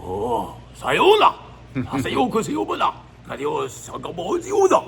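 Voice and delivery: throaty fake Japanese, leaping sharply high; mock Japanese gibberish, all from the throat; guttural mock Japanese. Shown as a demonstration. Comedian demonstrates how Japanese sounds, guttural mock Japanese. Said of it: "guttural, all from your throat".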